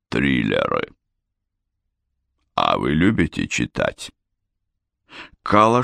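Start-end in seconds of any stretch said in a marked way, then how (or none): 0.1-4.1 low, soft good-natured voice; friendly and low. A narrator's reading. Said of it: "Он добродушно улыбнулся".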